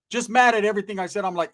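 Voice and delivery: usual speaking voice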